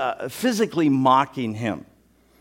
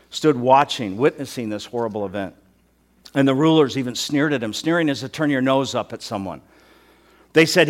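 normal talking voice

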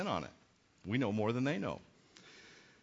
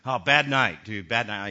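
fisherman answer; 4 semitones lower, 8 dB louder than usual. Retold or imitated. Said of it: "imagined fishermen reply".

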